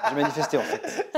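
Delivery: laughing while talking. Real, not acted. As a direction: laugh through the words. Laughing at own remark.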